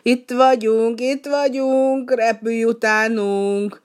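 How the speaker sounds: drake leader calling. Acted. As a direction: call the lost duck home from the sky. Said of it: "a gácsér hápogni kezdett".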